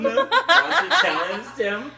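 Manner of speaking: high, loud, slow voice; mock character voice. Narrator hamming a line for the listener. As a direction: ham it up.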